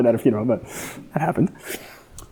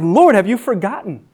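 raised, pleading questions to God; anguished prayer; 8 semitones higher than usual. Retold or imitated. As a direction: cry out to God in doubt. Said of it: "Questions like".

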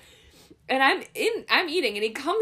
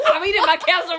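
fast, louder, laughing mock outrage; 7 semitones higher, 8 dB louder, much faster than usual. Wife laughing as she protests that she is eating her casserole. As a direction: blurt it out fast through laughter.

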